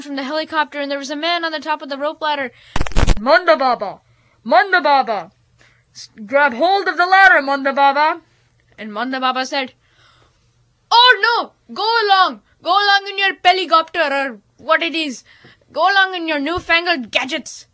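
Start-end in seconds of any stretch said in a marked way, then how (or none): 3.2-8.2 raised, loud call; shouting down. A narrator's reading. Man on helicopter ladder calls. 10.9-17.7 high, cranky scoffing; a grumpy old man waving it off; scornful dismissal. A narrator's reading.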